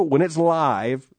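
live slowed right down and leaned on; live drawn out, slow and weighty; stress on live. Shown as a demonstration. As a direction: hit the word live hard and stretch it. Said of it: "emphasis on the word live".

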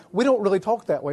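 usual speaking voice

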